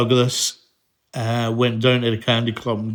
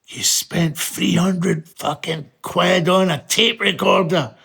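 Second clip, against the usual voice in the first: slow, higher-pitched mock indignation; unhappy dad; 5 semitones higher than usual. Dad appalled at what his sons bought, imitated indignation. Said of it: "he was not very happy about that".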